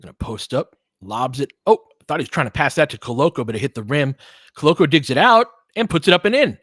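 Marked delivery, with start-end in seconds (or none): 1.7-6.6 rising, louder play-by-play as the putback drops; excited call. Real, not acted. Commentator cheering a lucky putback, excited. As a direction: lift the voice as the ball goes up and in.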